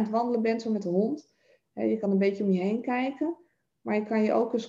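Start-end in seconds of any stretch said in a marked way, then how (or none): none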